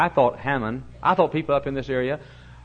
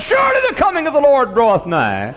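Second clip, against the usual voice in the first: high, loud, biblical proclamation; preacher shouting scripture over thrown-out greens; mock prophetic shout; 11 semitones higher, 8 dB louder than usual. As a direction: boom it like doom is near. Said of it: "I said".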